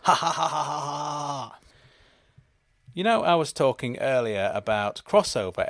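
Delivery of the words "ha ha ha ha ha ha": hammed-up evil ha ha ha; evil laugh. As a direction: gloat like a cartoon villain. Villainous gloat after reveal.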